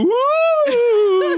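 high, loud ghostly "woo". Retold or imitated. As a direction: hoot it like a spooky ghost. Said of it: "he'll go".

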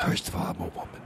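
hushed, breath-only singer's whisper; voice-saving whisper. Acted. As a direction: whisper to spare your precious voice. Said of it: "tell people you're a singer".

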